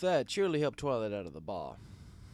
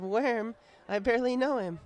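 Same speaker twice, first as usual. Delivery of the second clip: high, loud punchline delivery; high drunk mare cracking a joke; tipsy Twilight voice; 12 semitones higher, 7 dB louder than usual. Acted. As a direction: land the joke, giddy and tipsy.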